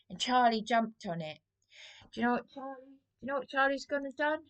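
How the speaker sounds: ordinary talk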